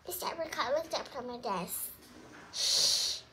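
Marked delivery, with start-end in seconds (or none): 2.5-3.2 unvoiced hush; shushing. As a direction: hush the room sharply. Teacher hushes the class.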